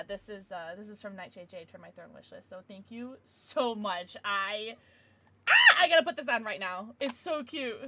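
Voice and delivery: normal talking voice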